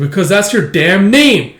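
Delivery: loud, high, drawn-out comeback; retold quarrel yell; acted angry retort. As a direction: fire back in exasperation, leaning on the words. Retold quarrel, answering back.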